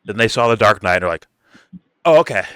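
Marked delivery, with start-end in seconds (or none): 2.0-2.4 a sudden mock "oh, okay", higher and louder; mock realization. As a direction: play the converted snob, pretend-surprised.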